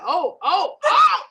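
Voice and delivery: rising panicked oh oh OH ending in a squeal; shrieking like a scared kid; hammed-up panic squeal; mock panic.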